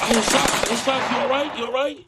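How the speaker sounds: light up like you found your friend; excited. Acted. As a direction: dispatcher thrilled to hear a friend on the line, excited. Dispatcher greets gamer buddy excitedly.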